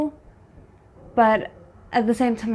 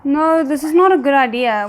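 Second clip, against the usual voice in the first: alarmed outcry; 4 semitones higher, 9 dB louder than usual. Real, not acted. Alarmed reaction to drama scene.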